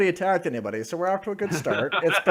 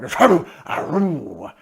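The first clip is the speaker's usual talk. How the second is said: growling dog voice; 3 dB louder than usual. Acted. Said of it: "you know, growling".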